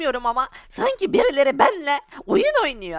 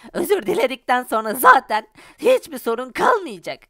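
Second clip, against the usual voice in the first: ordinary talk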